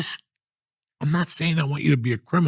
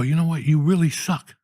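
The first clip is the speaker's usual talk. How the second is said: building from snide to full furious shouting; angry rant; 8 semitones higher, 5 dB louder than usual. Retold or imitated. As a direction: tear into him louder and louder. Yelling at the airport kid.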